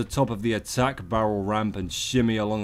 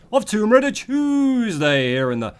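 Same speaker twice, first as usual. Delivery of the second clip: hammy announcer; 10 semitones higher, 5 dB louder than usual. Acted. Hammed-up show intro.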